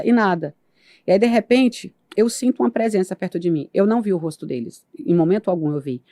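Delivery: ordinary talk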